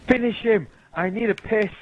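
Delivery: high, loud heckle; re-enacted ringside yelling; retold shout. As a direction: yell it like a spectator urging on a fighter. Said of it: "shout as loud as I can".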